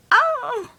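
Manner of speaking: startled yelp. Real, not acted. Startled by birds outside.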